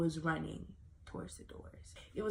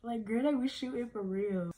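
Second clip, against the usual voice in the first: amused retelling; 5 semitones higher than usual. Retold or imitated. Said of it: "I thought it was funny".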